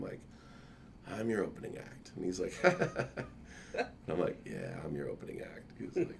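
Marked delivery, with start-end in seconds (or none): 4.4-5.7 whispered, much lower take. Retold or imitated. Telling her friend again in a whisper. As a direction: repeat it as a whisper.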